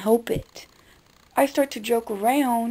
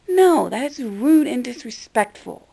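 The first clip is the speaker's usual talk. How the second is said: ordinary talk, nothing marked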